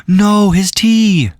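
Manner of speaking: gasping, high alarmed cry; hammed-up shock over tea; mock gasp of horror.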